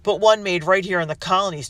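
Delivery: loud, sneering taunt; mock him through the door. A narrator's reading. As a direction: rebel jeering at the merchant, taunting. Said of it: "taunted another".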